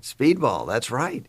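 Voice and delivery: ordinary talk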